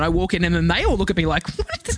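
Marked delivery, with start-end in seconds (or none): 1.6-2.0 very high, softer mock shock; imitated disbelief. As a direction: imitate aghast onlookers. Shocked women staring at her.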